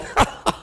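a short mocking laugh; brief scornful chuckle; God's laugh. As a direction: laugh down at them as God. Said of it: "God's going".

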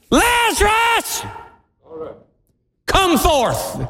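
a very high, drawn-out shout of Lazarus, slow; yell. Shown as a demonstration. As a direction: call the dead man out of the tomb. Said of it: "so pardon me, I'm gonna yell".

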